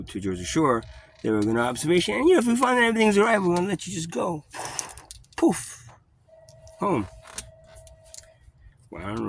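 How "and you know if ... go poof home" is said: high, fast singsong mockery; mocking cop promise. Mocking the cops' promise to let him go, high and fast. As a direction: parody the cops' breezy reassurance.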